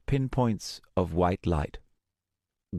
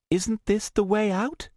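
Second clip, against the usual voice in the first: put-on puzzled young woman. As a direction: ask in puzzlement. Luna asks Starbreeze.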